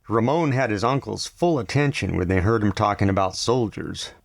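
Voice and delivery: ordinary delivery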